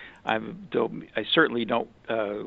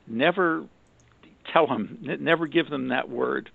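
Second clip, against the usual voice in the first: normal talking voice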